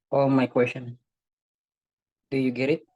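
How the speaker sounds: ordinary talk, nothing marked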